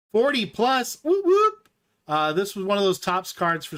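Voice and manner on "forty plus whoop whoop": real whooping celebration, much higher and louder; excited cheer. Real, not acted. Thrilled streamer celebrating viewers' likes, whooping.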